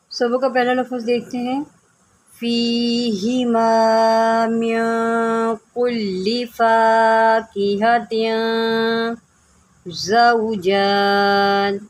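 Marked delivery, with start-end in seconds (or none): 2.3-11.8 slow, drawn-out tajweed chanting; measured melodic Quranic recitation. Shown as a demonstration. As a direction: recite slowly, stretching each vowel.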